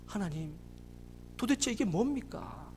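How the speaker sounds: retold reproach to God, held low; defiant protest. Father challenging God at the sickbed, subdued retold defiance. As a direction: start a bitter complaint quietly and slowly. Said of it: "하나님한테 삿대질하고 대들었답니다".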